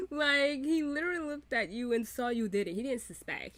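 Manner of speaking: usual speaking voice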